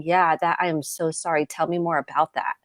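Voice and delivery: soft, unhurried acknowledgement; accepting apology. Acted. Mom hearing out her hurt daughter, softly apologetic.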